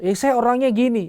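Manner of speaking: high mocking quote; blurted childish excuse. Preacher mimicking people who blurt things out, high mock voice. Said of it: "orang kalau nyeplos-nyeplos".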